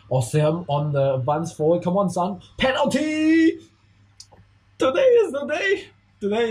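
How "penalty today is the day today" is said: high laughing cheer; excited shout. Real, not acted. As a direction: shout it out with joy. Penalty won.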